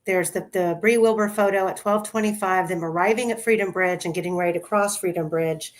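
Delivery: ordinary talk, nothing marked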